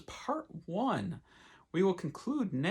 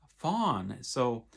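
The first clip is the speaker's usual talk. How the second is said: ordinary delivery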